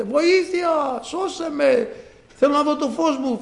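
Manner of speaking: high-pitched acted plea for help; crying out. Blind man calling to Jesus for his sight, crying out. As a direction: call out desperately, pitch far up, without full volume. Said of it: "φώναζε".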